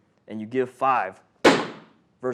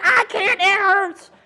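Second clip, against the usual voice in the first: far higher, laughing mock whine; whiny patient; 8 dB louder, slower than usual. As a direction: whimper it like a patient who won't hold still. Voices the patient again, very high.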